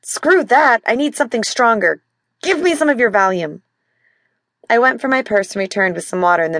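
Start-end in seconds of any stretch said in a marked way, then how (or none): 0.0-3.6 raised, high, snappish; irritable demand. Acted. Husband rejecting the Advil and demanding Valium, irritable. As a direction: brush her off and demand more.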